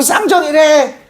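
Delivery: high-pitched schoolkid jeering; teasing classmates. Retold or imitated. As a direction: imitate kids chanting a mocking nickname. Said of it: "선생님이 놀리기 시작하니까 애들도 같이".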